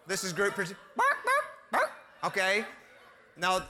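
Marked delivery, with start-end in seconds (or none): 1.0-1.9 squeaky imitation of a dog barking; high yappy dog barks. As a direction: yip it out like a little dog. Toy dog bark imitated.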